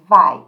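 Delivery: "vai" pronounced loud, as one syllable; pronunciation demo. Shown as a demonstration. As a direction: sound out "vai" forcefully. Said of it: "work on the last syllable only".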